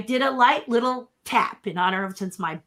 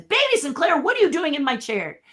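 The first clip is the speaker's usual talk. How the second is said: higher, indignant question; mock indignant boss; 8 semitones higher than usual. Eisner scolding the puppet baby, higher and indignant. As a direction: play the offended CEO finding someone in his chair. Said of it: "Michael Eisner comes in and says".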